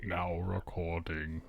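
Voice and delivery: ominous spooky voice. Retold or imitated. Echoing the recording notice, ominous spooky voice. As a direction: intone it slowly and quietly, mock-spooky. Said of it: "spooky voice for".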